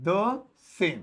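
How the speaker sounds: "the same" said slowly as a teaching model; slow model pronunciation. Shown as a demonstration. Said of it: "हम English में बोलेंगे the same".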